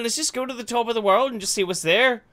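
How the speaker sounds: high, loud put-on Irish brogue; bad Irish accent. Freezing Irish explorer blaming his leader, in a hammed-up high Irish brogue. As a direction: ham up a clumsy Irish voice. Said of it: "butchering our fucking native accent".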